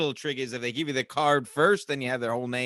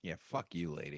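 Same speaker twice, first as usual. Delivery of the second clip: low, quiet grumbled agreement; muttered echo; 8 semitones lower, 15 dB quieter than usual. Acted. Co-host echoing the curse at the customer, low muttered. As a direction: mutter it back under your breath.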